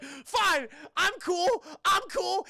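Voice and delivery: high, loud, defensive mock shout; stung insistence. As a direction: blurt it high, protesting too much. Stung character insists, giving in.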